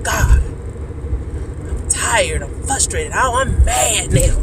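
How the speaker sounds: retold frustrated cry to God; fed-up anger. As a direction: complain to God, building to mad. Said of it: "when I got angry".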